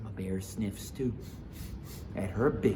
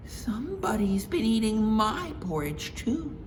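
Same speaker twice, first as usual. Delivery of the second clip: Mama Bear voice; 12 semitones higher than usual. Acted. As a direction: agree brightly with Papa.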